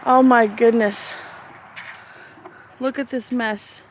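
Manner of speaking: ordinary talk, nothing marked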